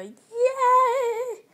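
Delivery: a high, loud yay; excited squeal. Real, not acted. Cheering as she shows off her hamster. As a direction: squeal with glee.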